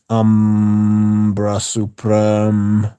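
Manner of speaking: Ambra Supreme said very slowly, each syllable drawn out; careful correction. Shown as a demonstration.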